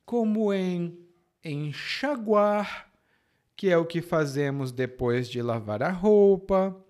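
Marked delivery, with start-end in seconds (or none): 1.4-2.9 "enxaguar" slow and higher, X as sh; "enxaguar" slowly, with a clear sh; sh sound. Shown as a demonstration. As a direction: model "enxaguar" drawn out as en-sha-guar. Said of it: "o som é de sh".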